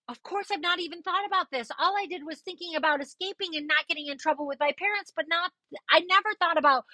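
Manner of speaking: fast, high, frantic self-quote; reenacting her own freak-out, fast and high; panicked rant. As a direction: rattle it off in rising panic. Retelling her own panicked thoughts.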